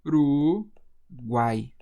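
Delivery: "Uruguai" very slowly as U... ru... guai; syllable by syllable. Shown as a demonstration. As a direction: sound out "Uruguai" with long held syllables. Teacher divides word into syllables.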